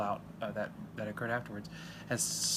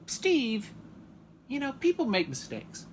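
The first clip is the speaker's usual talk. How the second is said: higher, louder, sing-song forgiveness; mock gracious; 12 semitones higher, 4 dB louder, slower than usual. Viewer writes to Steve Harvey, high, sweet mock forgiveness. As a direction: forgive him sweetly and loudly. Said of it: "I said".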